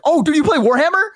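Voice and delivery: high, eager surprise; light up at meeting a fellow fan; a delighted nerd's squeal; excited gamer. Retold or imitated. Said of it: "the guy's like".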